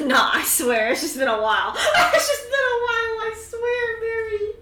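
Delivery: pleading through high, loud giggles; laughing too hard to get the words out. Real, not acted. Laughing hard while swearing to her friend. Teased about calling dog Jasper.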